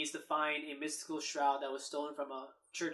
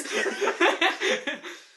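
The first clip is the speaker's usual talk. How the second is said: squeaky helium giggle; high squeaky laugh; 13 semitones higher, 10 dB louder than usual. Shown as a demonstration.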